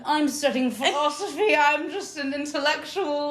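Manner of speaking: high, drawn-out pretentious scholar voice; hammed-up intellectual. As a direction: play a mock-pretentious student, pitched well up. Pretends to be Oxford intellectual while studying.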